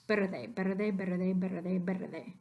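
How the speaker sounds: verde said as pet-ther-day, with a quick D-like tap for the R. Shown as a demonstration. Teacher shows students a compromise, verde as better-day. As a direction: run verde together fast so the R becomes a tap. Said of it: "Say that together several times".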